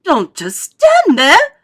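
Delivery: high, slow, loud scream. A narrator's reading. Wife screams at her idle husband. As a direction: scream each word at your husband. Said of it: "Missus Gloop screamed at Mister Gloop".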